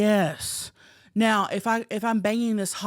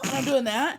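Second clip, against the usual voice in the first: ordinary talk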